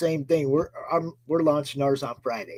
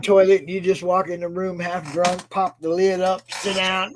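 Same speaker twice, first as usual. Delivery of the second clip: ordinary delivery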